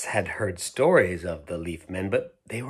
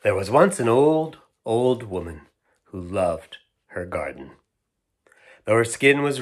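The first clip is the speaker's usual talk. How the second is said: storybook narration; 6 dB louder than usual. A narrator's reading. Parent reading a picture book, gentle storyteller voice. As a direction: read the tale aloud to a child.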